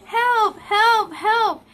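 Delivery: high, loud mock cries for help; toddler screaming. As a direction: yell help like a frantic toddler. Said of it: "he would scream".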